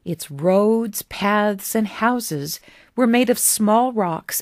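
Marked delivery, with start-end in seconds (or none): none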